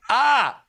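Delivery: high pained wince; sensitive teeth wincing. Real, not acted. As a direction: yelp at a twinge in the teeth. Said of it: "My teeth hurt".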